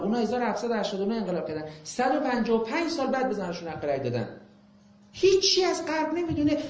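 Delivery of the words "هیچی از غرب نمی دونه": indignant. Real, not acted. Scolding critics of the West.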